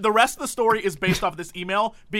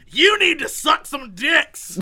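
mock quote; 6 semitones higher, 5 dB louder, slower than usual. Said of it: "But this girl's like".

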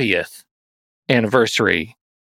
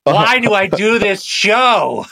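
high, slow, hammed-up whining; mock whine; 9 semitones higher, slower than usual. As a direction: groan it out like a put-upon host. Host mock-complains about the plug.

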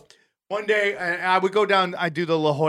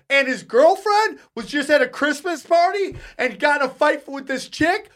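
higher pitched, breathless retelling; worked-up gossip; 9 semitones higher, 4 dB louder than usual. Retold or imitated. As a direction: spill the story, rattled. Staff retelling the fight, worked up.

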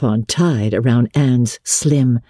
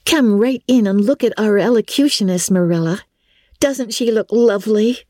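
put-on higher, admiring young friend, a touch dreamy; girl persuading her friend, lightly voiced higher; lightly voiced girl Diana; 8 semitones higher than usual. A narrator's reading. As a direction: coax her friend toward the organdy. Diana calling Marilla.

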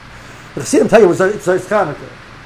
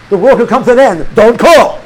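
alarmed man's reading; 4 semitones higher, 7 dB louder than usual. Retold or imitated. Man reads it as alarm.

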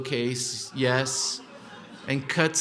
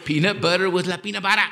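"la peanut butter" in a light Tijuana accent, quick and louder; Tijuana Spanglish; 7 semitones higher, 5 dB louder, faster than usual. Shown as a demonstration. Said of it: "I talk Spanglish too".

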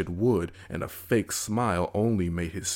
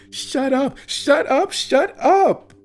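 shrill, much louder screams, over and over; little sister shrieking at the puppet; screaming; 10 dB louder, slower than usual. Acted. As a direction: scream to drown him out. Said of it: "this time in her own voice".

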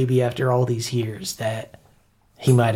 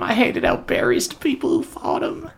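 teary; 9 semitones higher than usual. Acted. Cooter still teary.

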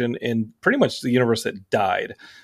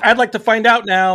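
lifted higher and louder, daring him; mock threat; 8 semitones higher, 5 dB louder than usual. Joking to a friend about a rude kid, a raised mock threat. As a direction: dare the kid to try it, voice raised.

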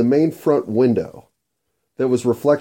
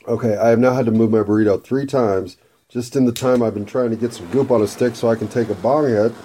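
normal talking voice

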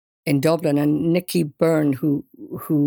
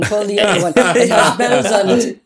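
high, loud teasing brush-off; laughing off a prank call, higher and louder; mock disbelief; 5 semitones higher, 6 dB louder than usual. To the supposed prankster on the phone, high loud mocking disbelief.

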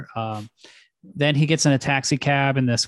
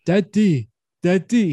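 imitated old man; 6 semitones higher, 3 dB louder than usual. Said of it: "That guy was like".

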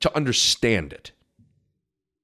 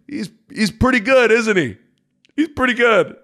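higher, teasing "pretty good" with a grin; smug teasing; 9 semitones higher than usual. Retold or imitated. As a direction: needle the fan playfully. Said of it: "give him a little smile".

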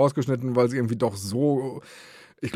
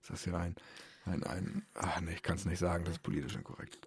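whispering; 6 semitones lower, 16 dB quieter than usual. Real, not acted. Whispered aside while eating.

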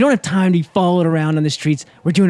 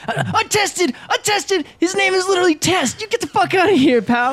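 much higher, laughing indignant yell; furious outburst; 14 semitones higher than usual. Acted. Player's character rages at the tail guy over the insult, high laughing yell. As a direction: blow up at the insult.

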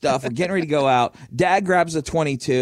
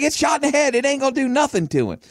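dismissive dad; 10 semitones higher, faster than usual. Retold or imitated. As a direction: wave off the worry. Dad dismissing mom's alarm.

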